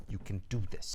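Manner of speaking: low, hushed mock self-affirmation; self pep talk. Surfer to his surfboard reflection, low and hushed pep talk. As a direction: murmur it low to yourself.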